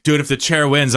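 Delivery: normal talking voice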